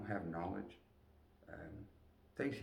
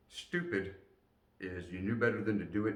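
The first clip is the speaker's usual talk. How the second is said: ordinary talk, nothing marked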